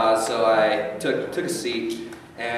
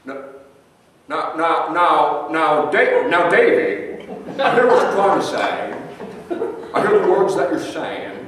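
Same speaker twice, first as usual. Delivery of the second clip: put-on higher, stammering, drunk old-film drawl; Jimmy Stewart impression; 5 semitones higher, 3 dB louder, slower than usual. A drunk bar patron questioned by the detective, stammering and higher. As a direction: stutter on every now like a tipsy Jimmy Stewart. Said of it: "He goes by Mister Stewart".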